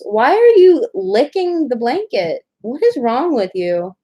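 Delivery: slow, high, drawn-out questioning; exasperated pet scolding. Real, not acted. Scolding a pet mid-story.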